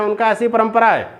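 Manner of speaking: normal talking voice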